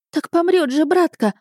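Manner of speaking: put-on high-pitched boyish protest; indignant. A narrator's reading. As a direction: object urgently, voice jumping up. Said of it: "возмутился старший". (возмущённый мальчишеский возглас)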